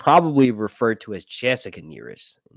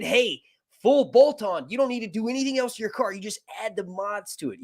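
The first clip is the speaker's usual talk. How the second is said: much higher put-on voice of a seller; mock sales pitch; 10 semitones higher than usual. Mod sellers assuring the car owner, high mocking imitation.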